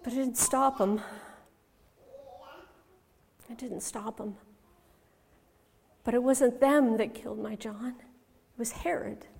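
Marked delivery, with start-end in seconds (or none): 3.5-4.4 very soft, sinking reflection; hushed repetition. Acted. Mother reflects that nothing stopped John, hushed repetition. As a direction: drop it almost to nothing. Repeated, much softer.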